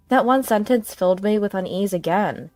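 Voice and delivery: ordinary delivery, nothing marked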